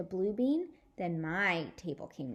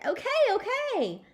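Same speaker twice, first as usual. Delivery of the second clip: eager chorus; 14 semitones higher, 6 dB louder than usual. Acted. Kids eagerly agreeing.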